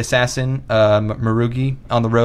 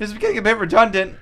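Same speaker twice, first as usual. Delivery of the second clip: high, mock-timid objection; meek caller; 10 semitones higher than usual. Bullied caller objecting to Chad's insults, meek and high. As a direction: protest feebly in a put-on high voice.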